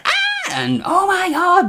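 very high, squealed mock fan scream; imitated crowd screaming. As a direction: squeal like a hysterical convention crowd. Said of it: "You just heard".

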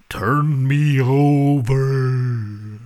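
slow, drawn-out ghostly title; spooky slow voice. Acted. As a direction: stretch every word like a ghost.

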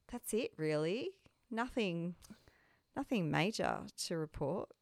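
usual speaking voice

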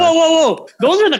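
high, loud quick protest; surprised objection. Real, not acted. Tattooer cutting off the host's jab, loud high protest. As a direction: stop him mid-sentence, loud and high.

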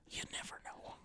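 soft teasing whisper; whispered cliffhanger. Pretend. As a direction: breathe it like a dramatic teaser. Dramatic teaser whisper.

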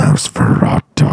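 very low, slow demon growl; deep demon voice. Retold or imitated.

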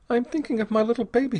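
high and fast, a choking catch in it. A narrator's reading. Mother thinks of her baby, choking up. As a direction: speak through a tightening throat.

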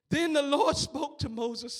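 usual speaking voice

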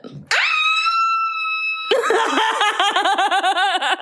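very high, long peal of laughter; squealing laugh; shrieking laugh. Real, not acted. Reaction to crude gossip.

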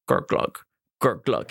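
low gurgling toilet noise; imitated slow drain gurgle. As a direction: gurgle like a clogged flush. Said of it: "it's like".

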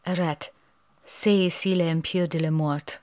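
reading the sign in a put-on French accent. Shown as a demonstration. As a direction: give the French a go, hammy accent. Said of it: "how was my French there".

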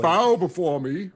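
lightly voiced dark lord; General Zod. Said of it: "I wanna look like General Zod".

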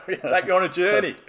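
hammed-up TV announcer; TV show announcer. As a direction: sell it like a show intro. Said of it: "This sounds like a T V show".

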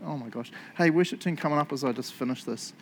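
ordinary delivery

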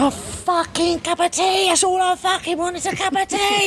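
mock wail. Prisoner protesting to officers, high whining wail. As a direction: wail it like a hard-done-by prisoner.

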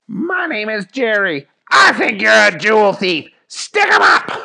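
high shout, building to a shriek; shouted arrest. Acted. Jerry accuses old Carl, building high shout. Jerry confronts suspected thief.

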